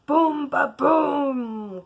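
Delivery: loud booming thunder noise. Acted. Narrator voicing the crashing thunder, loud boom.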